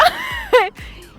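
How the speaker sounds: loud, high-pitched laugh; real burst of laughter. Real, not acted. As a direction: crack up mid-lesson. Cracks up while modelling phrases. (громкий высокий смех)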